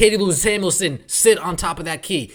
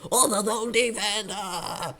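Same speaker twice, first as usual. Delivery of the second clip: higher, softer dazed mock coach voice, trailing off; mocking clueless coach; 3 semitones higher, 4 dB quieter than usual. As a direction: play a lost coach who froze. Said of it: "Chrissy Sides leaves the same bum".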